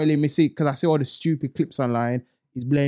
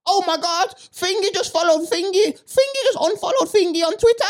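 much higher, breathless celebrity-gossip voice; mocking gossip fan; 3 dB louder than usual. Mocking fans who follow celebrities, in a shrill high gossip voice. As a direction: squeal it like an obsessed gossip blogger. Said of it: "follow it like it's their job".

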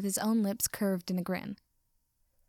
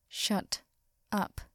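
growled, word-by-word. Acted. Flustered repeat of growled order.

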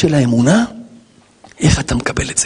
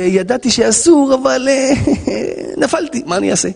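faltering excuse with a breathy laugh; sheepish confession; 9 semitones higher than usual. Acted. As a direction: admit it haltingly, then give in helplessly. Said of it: "עוון".

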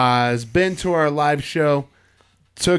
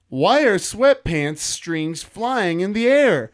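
headline read; 5 semitones higher, much slower than usual. Acted. Reading headline out.

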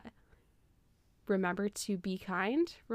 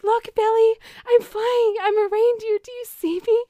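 much higher and louder, giddy and amazed; joyful cow; 14 semitones higher, 9 dB louder than usual. Retold or imitated. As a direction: squeal it like a cow who just learned to fly. Cow voiced flying as reindeer.

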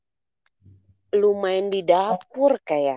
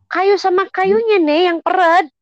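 higher, louder protesting; 11 semitones higher, 5 dB louder than usual. Real, not acted. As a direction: raise the voice to object. Correcting friend's oiling tip.

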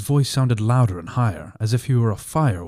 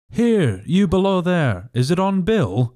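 high, slow call; cried out; 8 semitones higher, slower than usual. A narrator's reading. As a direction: call down the road, demanding. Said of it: "cried the blind man again".

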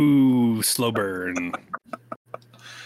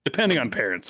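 ordinary delivery

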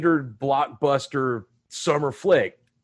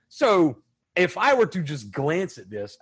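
usual speaking voice

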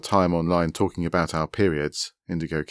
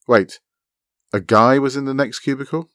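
louder, higher, rising question; startled confusion; 7 semitones higher, 6 dB louder than usual. A narrator's reading. Friend puzzled by a man in the next cubicle, startled. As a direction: stop short and query it in disbelief.

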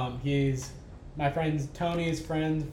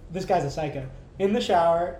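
usual speaking voice

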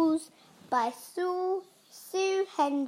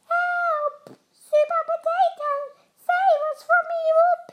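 very high, squeaky fake victim voice; put-on cry for help; 13 semitones higher, 5 dB louder than usual. A narrator's reading. Evil pea luring Super Potato as pretend veggies, high shout. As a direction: call out in a falsetto, pretending to be helpless. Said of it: "he shouted in his best voice".

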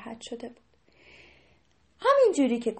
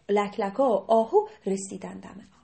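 ordinary delivery

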